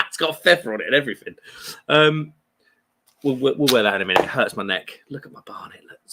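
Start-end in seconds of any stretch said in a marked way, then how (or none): 5.1-6.1 whispered aside about his messy hair. Real, not acted. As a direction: hush it like a sheepish confession.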